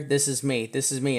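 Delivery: ordinary talk, nothing marked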